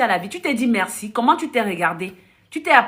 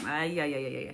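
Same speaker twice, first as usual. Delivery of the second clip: mock soft wince; 8 semitones lower, 13 dB quieter than usual. Mock wince after being knocked down.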